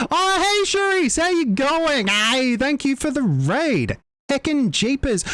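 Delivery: very high, excited call-out; thrilled greeting. Real, not acted. Streamer thanking a friend for the raid, thrilled. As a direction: squeal a delighted hello to an arriving friend.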